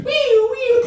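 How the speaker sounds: high, loud siren wail; siren noise. Pretend. Playing the ambulance arriving, a shrill siren imitation.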